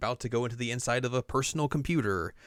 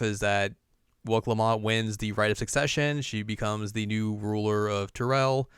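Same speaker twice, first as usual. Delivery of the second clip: ordinary delivery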